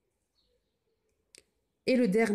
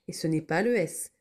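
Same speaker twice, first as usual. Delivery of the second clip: ordinary delivery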